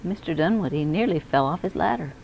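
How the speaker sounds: usual speaking voice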